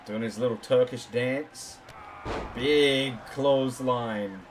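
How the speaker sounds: wrestling commentator. Acted.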